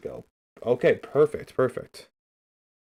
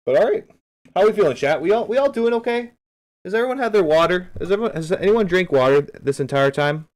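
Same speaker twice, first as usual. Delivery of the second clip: higher and louder, pumping up the chat; hype host; 4 semitones higher, 4 dB louder than usual. Real, not acted. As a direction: check in on the viewers with big energy. Hyping up the stream chat.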